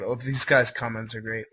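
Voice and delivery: normal talking voice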